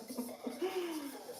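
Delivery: soft breathy laugh. Real, not acted. Laughs at own joke.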